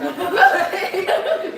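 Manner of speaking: laughing while saying it, slowly; real laughter through the words. Real, not acted. Laughing at a question about cousins.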